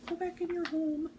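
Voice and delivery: high, sing-song command. Real, not acted. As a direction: pitch it way up to coax. Repeated command, two deliveries.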